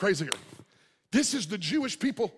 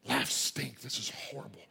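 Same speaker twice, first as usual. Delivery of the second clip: low, half-whispered muttering; low complaint fading into a whisper; murmurer's grumble; 6 semitones lower, 6 dB quieter than usual. Acted. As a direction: grumble it bitterly under your breath. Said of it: "A murmurer is".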